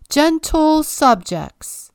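slow, high, loud royal proclamation; king ceremoniously greeting his subjects; grand address. A narrator's reading. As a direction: announce it like a pompous king.

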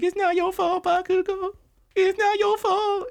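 high, comforting parody; mock tender consoling. Host imitating All Might comforting Bakugo, high mock-tender. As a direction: coo it like a consoling parent. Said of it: "All Might tells him".

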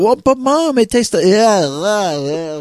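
put-on very high child's protest tailing into disgust; whining kid. Kid protesting to Mom about tasting vanilla, whining kid. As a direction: imitate a kid pleading, then recoiling at the taste.

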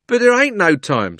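high, loud urgent cockney; cockney cabby. A narrator's reading. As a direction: urge it hastily. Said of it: "said the cabby".

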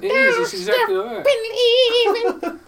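very high slow witch voice; creepy witch voice. Pretend. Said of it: "You sound like a creepy witch".

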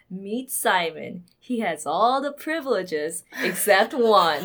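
slow, dramatic movie trailer narrator; trailer voiceover. Retold or imitated. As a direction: announce it like a film trailer. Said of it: "I can hear a trailer voiceover going".

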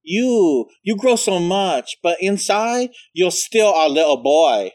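high, slow, sing-song gush; imitating flamboyant boyfriend. Boyfriend cooing to grown nephew Michael, high and drawn out. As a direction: coo it like a doting uncle. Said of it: "I just remember him being like".

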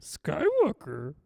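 slow, strained little Yoda. Acted. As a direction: croak it slowly like Yoda. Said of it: "that was Yoda".

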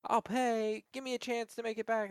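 high, loud bargaining voice; mock pleading debtor. Imitating debtors bargaining for their car.

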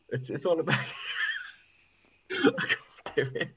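words breaking up into laughter; helpless laughing. Real, not acted. Giving up on the Romanian accent, helpless laughter. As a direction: try the line and collapse laughing. Cracks up attempting the accent again.

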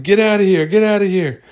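high, loud shooing; alarmed shooing. Acted. Crew to the huge bird, high alarmed shooing.

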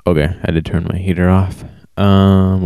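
usual speaking voice

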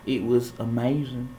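put-on southern accent; playful, cringy southern accent. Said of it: "let me drop this accent".

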